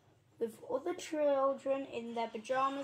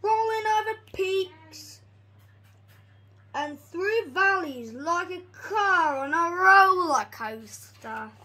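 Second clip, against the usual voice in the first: building excitement; 9 dB louder than usual. A narrator's reading. Telling the wild train ride to children, thrilled. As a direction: ride the rollercoaster with your voice.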